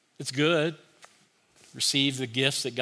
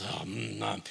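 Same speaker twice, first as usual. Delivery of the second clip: soft, contented munching sounds; happy eating noise; 4 semitones lower, 6 dB quieter than usual. Shown as a demonstration. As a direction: savour the food like a small child. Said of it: "This is how we get this little phrase".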